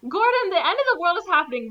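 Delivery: telling Gordon the world is ending, fast exasperation; exasperated. Retold or imitated. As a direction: rattle it off in disbelief.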